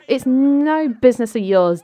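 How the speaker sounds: high, loud snap back; indignant retort. Acted. Mary Sue telling her ex to butt out, indignant. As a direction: push it out high and forceful.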